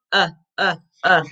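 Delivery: "Uh" as a short, low vowel; short U sound. Shown as a demonstration. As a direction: separate short beats of "Uh". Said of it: "what's the U sound".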